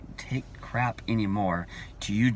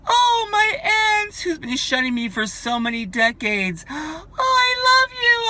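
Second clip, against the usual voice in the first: much higher, loud, sobbing gush of fake joy; mock lovey-dovey gushing; 7 dB louder than usual. Mock gush at shunning parents, lovey-dovey and tearful. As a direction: ham up a tearful, overjoyed reunion. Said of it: "I'm gonna be all lovey-dovey".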